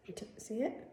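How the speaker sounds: breathy whisper to the camera. Real, not acted. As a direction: whisper as you show it. Showing bruise on camera.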